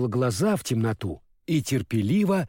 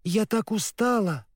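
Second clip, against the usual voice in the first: tired voice; 7 semitones higher than usual. Acted. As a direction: say it exhausted. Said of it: "ответила Элли".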